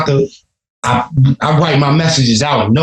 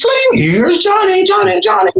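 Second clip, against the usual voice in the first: hammed-up movie shout. Acting out a film line for the album, hammed-up shout. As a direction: stretch out the catchphrase in a piercing high call.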